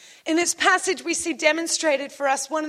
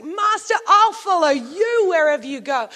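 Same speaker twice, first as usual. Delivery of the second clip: enthusiastic yell; 4 dB louder than usual. Acted. Next man pledging to follow the master, enthusiastic yell. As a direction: call out keenly from a crowd. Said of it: "they're enthusiastic".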